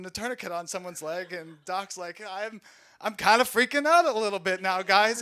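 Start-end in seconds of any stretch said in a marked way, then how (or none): 2.3-5.2 high, loud mock Walken panic. Medic admits panic to the squad, Walken voice. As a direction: still in character while freaking out. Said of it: "I think I'm gonna start talking normal".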